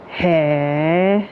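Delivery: drawn-out questioning "Hey" with a rising tune; Japanese-style "hey?" of surprise; Japanese surprised "hey". Shown as a demonstration. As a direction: say "Hey" like you're taken aback. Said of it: "you know how I say".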